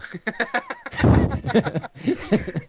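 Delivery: high squeaky manatee call. Retold or imitated. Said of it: "that's my best impression of a manatee".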